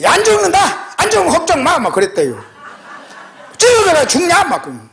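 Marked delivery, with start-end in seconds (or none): 0.0-1.8 high-pitched, emphatic imitation of a daughter; imitated scolding daughter. Daughter telling off her worried mother, imitated scolding. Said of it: "딸내미가 막 뭐라 그랬어요". 3.6-4.4 loud, high retort in a daughter's voice; imitated scolding daughter. Daughter dismissing mother's panic, imitated scolding. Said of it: "딸내미가 막 뭐라 그랬어요".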